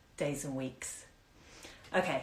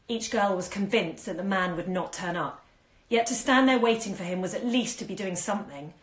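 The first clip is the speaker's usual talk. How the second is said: usual speaking voice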